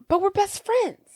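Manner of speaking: high, plaintive protest; mock hurt plea. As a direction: plead like a wounded best friend, high-pitched. Retold hurt plea to a friend.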